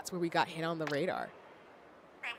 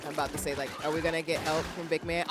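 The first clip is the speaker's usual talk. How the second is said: ordinary talk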